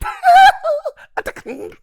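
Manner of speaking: high-pitched explosive mock laughing fit; imitated celebrity laugh. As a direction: imitate a star cracking up in loud, very high bursts. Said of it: "like, I'd never heard his laugh before. He's like".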